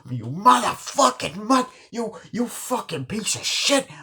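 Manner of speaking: very high, loud mock rage, slowing on the curse; retold furious outburst. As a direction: act out a glass-smashing tantrum. Acted rage after smashing glass.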